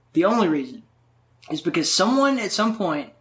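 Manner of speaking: normal talking voice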